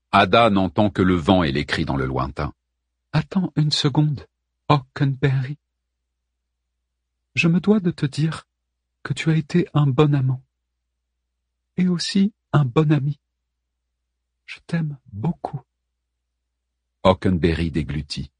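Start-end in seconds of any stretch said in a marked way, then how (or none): 3.1-13.2 Helen's voice. Acted. Helen speaks to her lover. 14.4-15.6 higher, quiet tender Helen. Acted. Helen declares her love.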